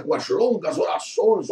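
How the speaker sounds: ordinary delivery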